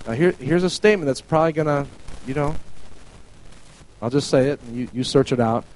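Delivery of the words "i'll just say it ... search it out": ordinary talk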